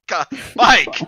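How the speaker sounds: loud, high scandalized yell; outraged shout. Real, not acted. Outraged at friend's screenshot.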